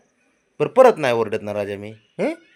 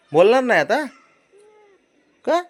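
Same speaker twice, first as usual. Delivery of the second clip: high, louder wheedling question; coaxing question; 7 dB louder than usual. Real, not acted. Father coaxing sulking child. (उंच सुरात लाडाने विचारणा)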